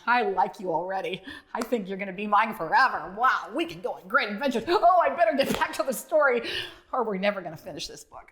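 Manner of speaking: ordinary delivery, nothing marked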